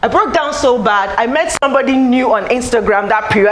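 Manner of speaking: usual speaking voice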